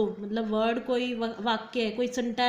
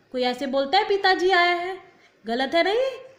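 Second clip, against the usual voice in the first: higher, louder rhetorical disbelief; incredulous questioning; 6 semitones higher, 5 dB louder than usual. Shown as a demonstration. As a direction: challenge the class, as if nobody could say that. Mocks an obviously wrong sentence. (हैरानी से सवाल करना)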